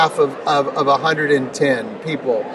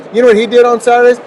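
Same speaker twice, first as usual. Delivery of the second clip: emphatic rhetorical question; 6 semitones higher, 7 dB louder, much faster than usual. Real, not acted. Building rhetorical question to interviewer.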